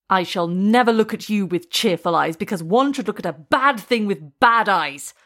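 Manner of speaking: usual speaking voice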